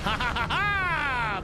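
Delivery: slow hammed-up villain laugh; evil cackle.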